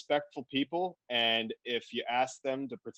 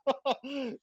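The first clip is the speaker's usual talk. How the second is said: high burst of laughter; 11 semitones higher than usual. Real, not acted. Laughing at own joke.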